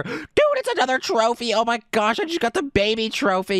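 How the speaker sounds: high-pitched, thrilled exclamation; excited discovery. Real, not acted. Gamer finding the baby trophy, excited.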